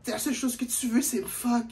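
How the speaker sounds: put-on high whiny girlfriend voice; mocking the girl. Storyteller mocking the girl's whining complaint.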